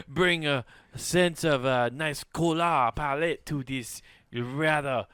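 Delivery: slow, savored mock-French musing; French accent. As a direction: play a slow-talking French aesthete. Acting French onlookers.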